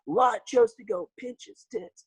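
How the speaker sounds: high, drawn-out mock preaching; flubbing preacher impression. Guest re-enacting a viral sermon slip, mock preacher. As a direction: slowly stretch out the infamous flub. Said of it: "if you guys know this video".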